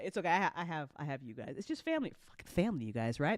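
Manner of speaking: normal talking voice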